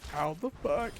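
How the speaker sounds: high, swooping put-on female voice; hammed-up high-pitched woman; exasperated woman impression. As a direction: whine it high as the baffled victim. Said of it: "that's how she sounds".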